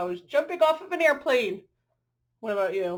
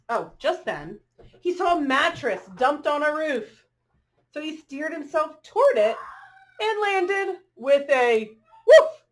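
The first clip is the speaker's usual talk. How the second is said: bright, rhythmic picture-book read-aloud; reading to children; 6 dB louder than usual. A narrator's reading. Reading a rhyming dog story to children, lilting storyteller voice. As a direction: read the rhyme to the little ones.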